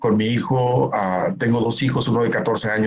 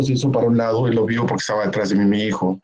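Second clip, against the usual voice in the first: usual speaking voice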